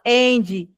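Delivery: "and" said as "endi"; Brazilian extra i. Shown as a demonstration. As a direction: put an i after the d. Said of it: "consoante no final". ("and" pronunciado "endi")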